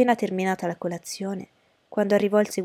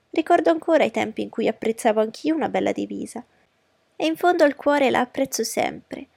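high, animated wife's voice; fussy mother, gushing; 4 semitones higher, 4 dB louder than usual. Acted. Wife reminiscing to her husband about officers, high and gushing. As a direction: chatter on in a raised, swooping pitch. Voices Mrs. Bennet in the story.

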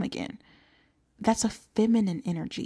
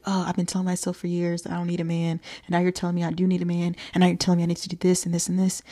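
fast and exasperated; rushed exasperated complaint; much faster than usual. Retold or imitated. Listener protesting to host, fast and exasperated. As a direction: rattle off the objections fast. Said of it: "I know it's like".

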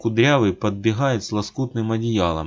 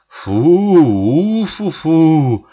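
отдуваясь; 8 semitones higher, 9 dB louder, much slower than usual. A narrator's reading. Отдувается в ледяной воде.

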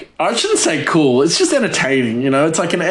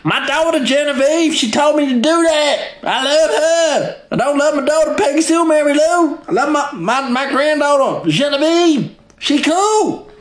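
much higher put-on voice of a doting hillbilly grandma; 14 semitones higher than usual. Hoarder grandma bargains with her granddaughter, high put-on doting voice. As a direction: coax the granddaughter like a fond old grandma. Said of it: "She's like".

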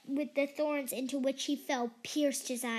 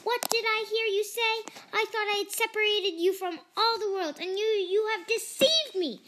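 high, loud furious enchantress; a shrieking-high witch betrayed by her ward; 7 semitones higher, 5 dB louder than usual. A narrator's reading. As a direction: rage at the girl who fooled her. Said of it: "cried the enchantress".

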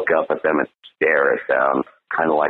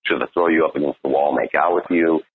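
ordinary talk

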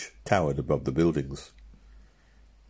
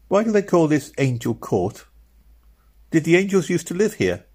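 put-on higher, curious small boy; little boy Robin; 11 semitones higher, 4 dB louder than usual. Robin asking Meg about Angel Court, higher and curious. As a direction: ask like a puzzled little brother.

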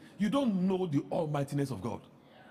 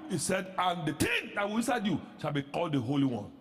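normal talking voice

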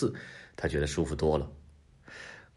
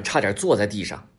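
ordinary delivery

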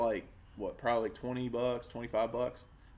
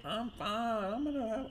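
put-on higher, airy drunk girlfriend voice; mock drunk girlfriend; 9 semitones higher, 7 dB quieter than usual. Mocking his drunk ex-girlfriend's higher voice. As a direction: play the tipsy girlfriend insisting she's fine. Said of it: "she's like".

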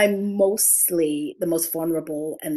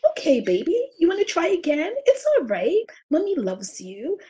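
put-on higher, louder sing-song comfort for a toddler; mother to baby; 10 semitones higher, 4 dB louder than usual. Mother reassuring her stumbling son, high sing-song cooing. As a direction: coo encouragingly at the baby. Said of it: "I'm always like".

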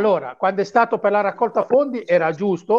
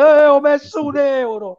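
mock bragging; 5 semitones higher, 5 dB louder than usual. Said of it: "esci fuori e lo dici".

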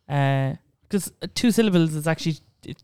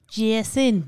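higher, drawn-out north side Jason; hammed-up north side accent; 5 semitones higher than usual. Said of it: "Any name in a north side accent".

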